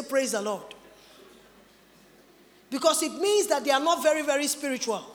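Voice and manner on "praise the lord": lower, softer holy-sounding voice; fake pious voice. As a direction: drop into a put-on spiritual tone. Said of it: "will not use their ordinary voice".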